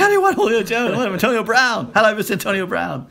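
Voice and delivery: high, laughing mock outrage, not really angry. Retold outrage at draft pick.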